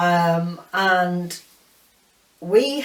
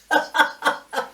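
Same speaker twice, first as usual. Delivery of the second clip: high giggle; 7 semitones higher than usual. Real, not acted. Laughing at old film.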